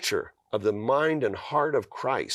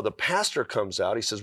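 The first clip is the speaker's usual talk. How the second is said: usual speaking voice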